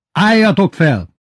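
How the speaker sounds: high, loud, drawn-out order; barked command. A narrator's reading. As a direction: order the class up. Teacher drilling the class. (hangos, nyújtott parancs)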